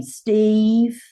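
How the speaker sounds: "Steve" with the vowel held long and high; drawn-out vowel. Shown as a demonstration. Guest guessing how the wife says it, drawled long vowel in "Steve". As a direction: draw out the middle of "Steve" in a Southern drawl. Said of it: "they draw out the vowels".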